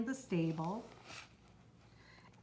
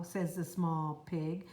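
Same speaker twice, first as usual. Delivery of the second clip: usual speaking voice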